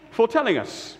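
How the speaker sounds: high, grateful thanks; disciples' thanks. Acted. The men thank Mary for telling them. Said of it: "said the men".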